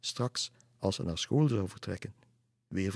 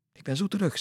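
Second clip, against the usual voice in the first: high, bright quick promise; girl telling the boy she'll be right back, eager; 12 semitones higher, 4 dB louder, faster than usual. Acted.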